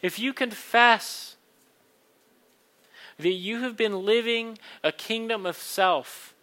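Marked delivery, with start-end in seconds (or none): 0.0-4.6 loud, earnest preaching voice; kindly vicar sermon. Acted. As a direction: preach it warmly to the room. Communion invitation, preached loudly.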